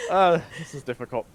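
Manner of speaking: breaking up laughing mid-sentence; struggling not to crack up. Real, not acted. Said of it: "It is so fucking hard to keep a straight face".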